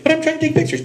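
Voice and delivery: high, whiny mock protest. Pulled over by his wife while taking pictures, a high whiny protest. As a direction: whine it way up like a kid. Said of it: "I was like".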